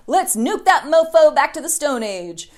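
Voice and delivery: mock rallying shout; gung-ho war cry. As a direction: bellow it like a cartoon hawk. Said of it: "were like".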